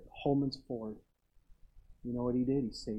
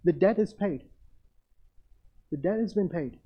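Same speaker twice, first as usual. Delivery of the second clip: raised, forceful proclamation; emphatic preaching; 4 semitones higher, 5 dB louder than usual. Real, not acted. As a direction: declare it to the whole congregation, pitch lifted. Preacher proclaims salvation to congregation.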